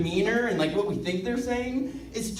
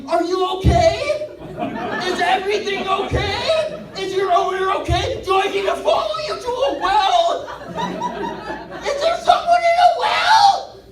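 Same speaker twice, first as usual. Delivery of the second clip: worried dog owner voice; 10 semitones higher than usual. Retold or imitated. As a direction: fret over the stray dog, voice cracking up high. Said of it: "are you like".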